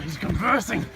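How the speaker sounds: normal talking voice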